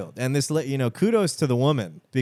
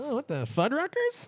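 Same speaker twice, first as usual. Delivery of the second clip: much higher, puzzled squeak; mock bewildered; 13 semitones higher, 6 dB quieter than usual.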